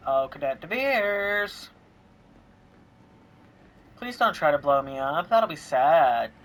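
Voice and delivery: loud, slow drawn-out call. Acted. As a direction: call across the room to him. Calling out to game character.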